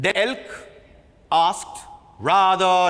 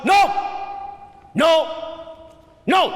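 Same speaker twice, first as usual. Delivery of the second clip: firm repeated no, flat refusal; categorical retort; 7 semitones higher, faster than usual. Acted. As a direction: shut him down, each no final. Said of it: "Elk retorted categorically".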